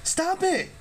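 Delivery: high, urgent call to stop; mild narrated yell. A narrator's reading. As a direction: shout at the bullies. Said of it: "Percy yelled".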